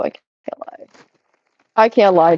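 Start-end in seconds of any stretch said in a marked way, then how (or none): 0.0-0.9 whispered aside. Real, not acted.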